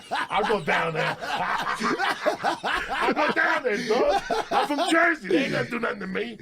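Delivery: cracking up, voice jumping high; laughing through the cocky punchline; laughing while telling it. Real, not acted. Telling friends the Jersey story, laughing hard.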